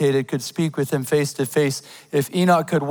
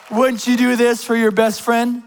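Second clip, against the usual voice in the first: usual speaking voice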